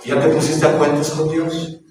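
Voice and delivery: ordinary talk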